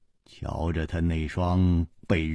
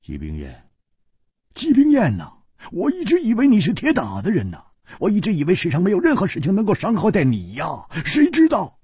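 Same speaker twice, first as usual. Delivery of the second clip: much higher, fast anguished lament; dejected; 10 semitones higher, faster than usual. A narrator's reading. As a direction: pour out grief over a broken friend. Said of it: "他黯然说".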